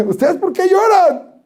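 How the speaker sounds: high, slow "lloran" with a laugh through it; laughing question. Shown as a demonstration. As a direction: ask "¿Ustedes por qué lloran?" high and slow, laughing. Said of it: "Muy, así".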